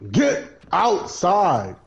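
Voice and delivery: shouting. Real, not acted. Urging teammate to flee.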